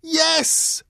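very high, loud cry; squeaky crowd shout; shouting little dogs. A narrator's reading. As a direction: yell it as a pack of small dogs. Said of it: "shouted all the little dogs".

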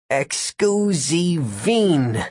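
slow, drawn-out magic words; spell incantation. A narrator's reading. Gary pointing a finger at Tyler, casting slowly.

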